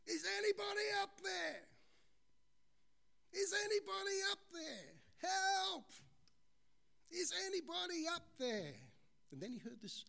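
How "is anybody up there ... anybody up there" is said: yelling for help. Acted. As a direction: call up the cliff for rescue. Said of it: "he started to yell for help".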